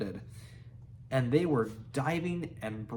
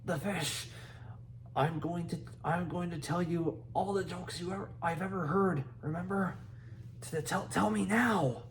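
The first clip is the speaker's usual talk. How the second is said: small dragon voice; 3 semitones higher, 4 dB quieter than usual. A narrator's reading. Stuttering dragon voice.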